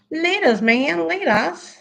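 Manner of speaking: high, jokey goodbye; lilting high-voiced send-off; playful sign-off. Playful sign-off to departing viewer.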